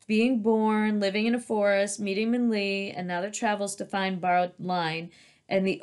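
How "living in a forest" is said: plain reading voice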